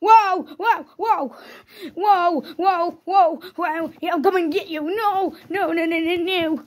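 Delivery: high, repeated cries of alarm; play-acted panicked chase voice; mock panic. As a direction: yelp as if running from a pursuer.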